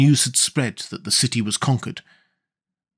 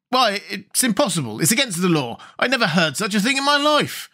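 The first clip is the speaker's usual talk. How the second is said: high-pitched, astonished protest; astounded; 7 semitones higher than usual. A narrator's reading. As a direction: protest in disbelief, pitch jumping up.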